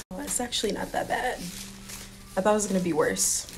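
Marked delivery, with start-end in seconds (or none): none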